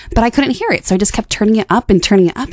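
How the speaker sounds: ordinary delivery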